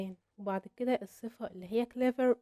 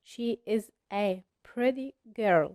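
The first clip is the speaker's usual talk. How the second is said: whole sentence drawn out very slowly; slow model sentence; 3 dB louder, much slower than usual. Shown as a demonstration. Teacher modelling the correct sentence, very slow. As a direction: read the correct sentence word by word. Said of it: "يعني تقول".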